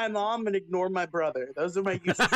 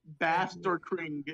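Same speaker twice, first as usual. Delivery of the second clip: slow deadpan meme; 2 semitones lower, 5 dB quieter, much slower than usual. Pretend. Mock slow meme phrase.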